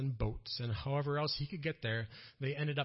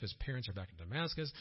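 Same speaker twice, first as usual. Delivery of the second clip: ordinary delivery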